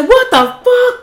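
mock outraged shock. Blindfolded man seeing the zipper, outraged shock. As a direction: explode in disbelief.

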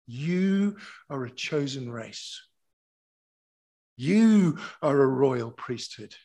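loud proclamation, leaning long on each "you"; emphatic scripture preaching. Real, not acted. Preacher telling the church who they are, loudly proclaiming. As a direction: declare it over them with full pulpit force.

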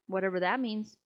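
usual speaking voice

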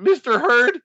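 high mock-exasperated objection, rushing by the end; imagined intern protest. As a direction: play an indignant intern challenging the boss. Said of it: "an intern".

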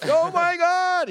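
high, slow drawn-out oh my God; mock astonishment. Seeing sleeping friend's twisted neck, slow high gasp. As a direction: stretch it in disbelief. Reacting to friend's split spine.

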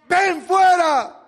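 orden gritada, lenta y muy fuerte; grito de mando. Acted. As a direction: ordena salir con voz de poder. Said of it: "Jesús gritó". (grito lento de mando)

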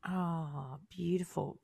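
soft whisper of awe; whispered beautiful. Real, not acted. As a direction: breathe it out softly. Soft reaction to guest's story.

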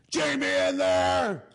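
put-on high, slow drunken holler up to a balcony; drawn-out drunk shout from below; drunk yelling. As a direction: bellow it up from the yard like a drunk. Drunk friend yelling from yard.

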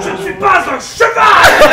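shouting. Acted.

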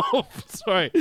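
laughing at own joke; cracking up mid-line. Real, not acted. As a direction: break into laughter mid-sentence. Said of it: "I laugh at my own jokes".

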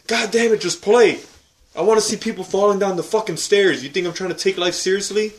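high, loud exasperated yelling at the screen; frustrated rant. Retold or imitated. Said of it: "I'm like".

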